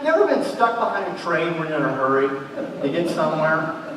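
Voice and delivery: ordinary talk, nothing marked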